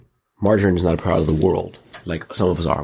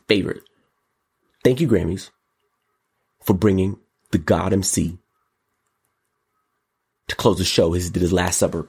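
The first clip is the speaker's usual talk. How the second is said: usual speaking voice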